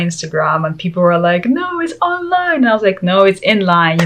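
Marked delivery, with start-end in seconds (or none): 1.5-2.6 high-pitched mocking contradiction; mock insistence. Followers arguing back on Instagram, high mock insistence. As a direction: imitate objectors in a much higher voice.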